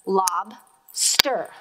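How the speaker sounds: "lobster" in two separate beats, lob, then ster; clapped-out syllables. Shown as a demonstration. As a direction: split "lobster" with a clear gap. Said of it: "clap that out and figure out how many syllables".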